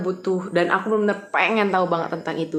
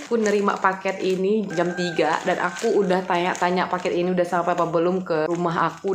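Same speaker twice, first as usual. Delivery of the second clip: ordinary delivery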